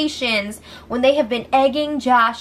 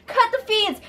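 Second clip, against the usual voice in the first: mock outcry; 11 semitones higher than usual. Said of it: "And then the feeds cut".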